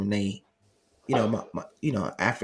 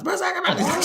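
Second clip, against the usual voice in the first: laughing exclamation. Retold or imitated. Mom compared to Wolverine.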